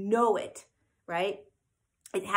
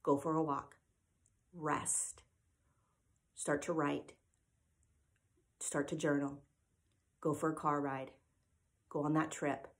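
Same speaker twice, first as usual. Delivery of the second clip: loving statements; 5 semitones lower, 9 dB quieter than usual. Retold or imitated. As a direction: calmly offer each gentle suggestion. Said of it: "loving blanket statements".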